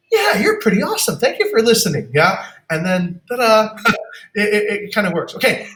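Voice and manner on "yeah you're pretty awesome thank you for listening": high, happy client voice; pleased client. Retold or imitated. Satisfied client thanking the new manager, high happy voice. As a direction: thank him like a won-over client. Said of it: "And then they'll go".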